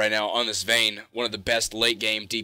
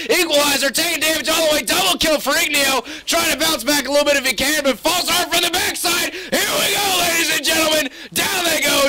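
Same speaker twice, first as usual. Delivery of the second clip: excited caster yelling; play-by-play shouting; 12 semitones higher, 5 dB louder than usual. Real, not acted. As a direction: call the teamfight at full hype. Live play-by-play climax.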